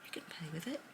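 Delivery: soft whispered reassurance; fairy whispering gently; gentle fairy whisper. Acted. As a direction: hush it kindly to the girl. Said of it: "and the fairy says".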